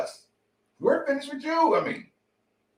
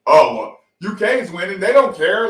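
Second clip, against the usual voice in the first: mocking rivals; 8 dB louder than usual. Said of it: "the same people that want".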